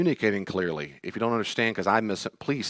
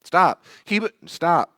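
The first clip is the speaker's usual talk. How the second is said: higher, louder commanding stop; a parent halting a spiraling child; firm stop; 7 semitones higher, 8 dB louder, much slower than usual. Retold or imitated. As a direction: cut the panic off hard. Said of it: "I go".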